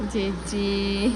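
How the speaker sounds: put-on very slow, drawn-out "જે જે" for the baby to copy; slow demo. Shown as a demonstration. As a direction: stretch out "જે જે" so the toddler can copy it. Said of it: "જે જે કઈ રીતે કરો છો?".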